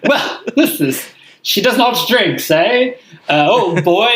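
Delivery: high, slowed, plummy party-boy impression; British playboy voice. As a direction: play the tipsy rich Brit holding a drink. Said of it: "always exclaiming things like".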